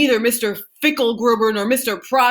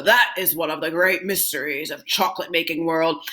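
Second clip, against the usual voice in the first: ordinary delivery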